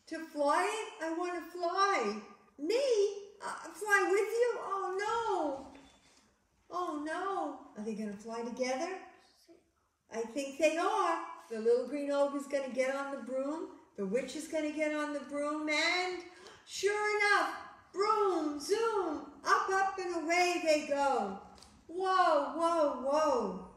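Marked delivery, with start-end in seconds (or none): 0.1-7.6 high-pitched, startled storybook ogre; alarmed surprise. Acted. Ogre reacts to flying invitation. 15.7-23.7 high, loud storytelling climax; excited narration. A narrator's reading. Narrating the broom taking off.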